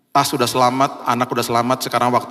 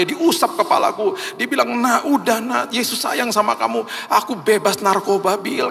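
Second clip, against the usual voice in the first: much higher, stirred retelling; emotional testimony; 8 semitones higher than usual. Retold or imitated. Friend telling the preacher how his father cared for him, high. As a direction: tell it with rising emotion.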